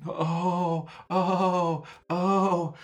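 high, rising moans; whimpering fear.

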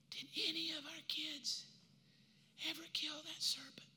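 fading voice from the deathbed; dying whisper. Acted. Dying Eve whispering a question to her husband.

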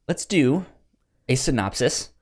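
usual speaking voice

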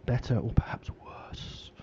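spooky teasing whisper. Acted. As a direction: breathe it like a ghost story. Spooky end-of-show question to guests.

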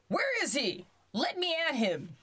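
high-pitched, contained demand; belligerent king. A narrator's reading. King picks up knife and fork for the duck, mildly belligerent.

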